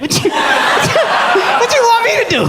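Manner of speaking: high, incredulous laughing rant; mock outrage at his girl; hammed-up incredulous; exasperated. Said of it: "I'm just like".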